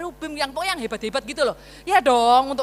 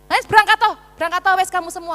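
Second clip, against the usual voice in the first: loud, higher, shooing them off; fed-up shout; 5 semitones higher, 5 dB louder, faster than usual. Acted. God sending the stubborn people off: loud fed-up shout. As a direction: bark them away in exasperation.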